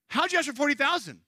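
high-pitched, incredulous outburst; astonished. Retold or imitated. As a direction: demand an explanation in sheer disbelief. Retold jaw-dropped reaction to the request.

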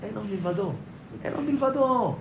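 a quiet chant said to yourself, over and over; murmured affirmation; murmured mantra. Shown as a demonstration. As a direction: softly repeat under your breath. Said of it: "תגיד בשפתיים".

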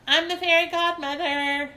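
high, very loud triumphant yell. Acted. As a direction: grab the role with a yell. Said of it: "she yelled".

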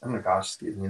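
burp through the words; speaking through a burp. Real, not acted. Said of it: "that coffee is making me burp".

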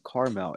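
"caramel" in two syllables, KAR-mel; two-syllable pronunciation. Shown as a demonstration. Telling people to stop saying it so, "caramel" as CAR-mel. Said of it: "Stop calling it caramel".

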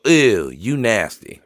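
slow, drawn-out mock disgust; mock-scolding mom, taking it slowly; imitating disgusted mom. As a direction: draw out the ew like an appalled mother. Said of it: "She would definitely go".

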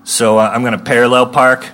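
ordinary talk

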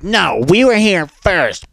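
"we were here first" dragged out high and slow; mocking whine. Mocking the rude girl's whiny claim to the spot. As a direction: whine it like a brat. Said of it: "And she's like".

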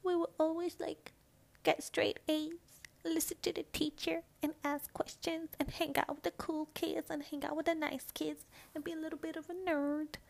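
high, small good-pupil voice; cute shy kid. Acted. Describing shy straight-A kids, high cutesy kid voice. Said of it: "we were, like".

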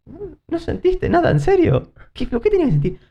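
high, incredulous retelling of the question; mock disbelief. Replaying the tremor confusion.

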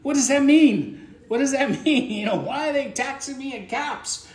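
high, loud mock indignation; alarmed overreaction. Texter reacting to a message in caps, hammed-up alarm. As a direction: act out a hammed-up panic, voice shooting up. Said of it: "They'll go, ... are they yelling at me".

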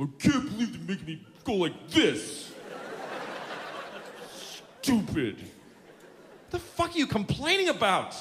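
a touch of mock fury; whiny then scolding. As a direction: rant at a spoiled ATM user. Whining at ATM then scolding.

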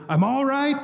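a retold mock yell of "I'm all right", pitched high; mock scream. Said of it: "he screams off the camera".

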